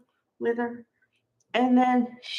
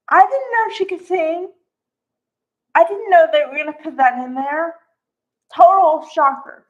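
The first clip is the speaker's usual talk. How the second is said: higher and louder, bursting with surprise; can't believe she could sing; excited disbelief; 5 semitones higher, 7 dB louder than usual. Real, not acted. Fan gushing to viewers about a surprise song, excited and loud.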